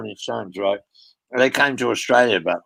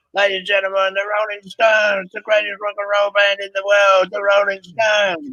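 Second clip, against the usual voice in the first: stage announcement; 9 semitones higher than usual. Retold or imitated. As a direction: shout it out to a stadium crowd.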